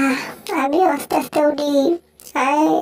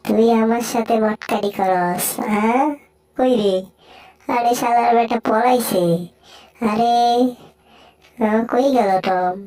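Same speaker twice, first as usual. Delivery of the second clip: lower-pitched character scolding; threatening cartoon bully; 4 semitones lower than usual. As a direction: taunt and threaten a beaten rival in a low voice.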